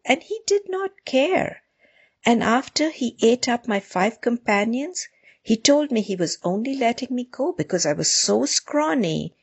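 a hint of a small, humble storyteller; meek. A narrator's reading. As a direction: plead your case meekly, pitch lifting. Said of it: "said the rabbit meekly".

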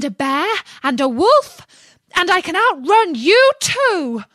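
high, loud storybook shriek; screamed out. A narrator's reading. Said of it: "screamed out".

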